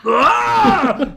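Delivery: high crying "oh"s that break into a laughing yell; mock sob flipping into a shout; sad turning mad. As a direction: sob then snap into fury, played for laughs. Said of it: "I'm flipping between, like, mad and sad".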